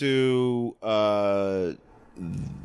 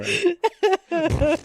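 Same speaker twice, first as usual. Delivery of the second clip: drawn-out, high and slow through a laugh; 4 dB louder than usual. Retold or imitated. Laughing reassurance to the host after falling. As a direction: squeak it out while laughing.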